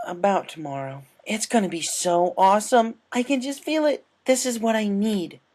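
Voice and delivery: can't wait, gushing about the race; excited. Acted. Rainbow gushing about tomorrow's race, excited.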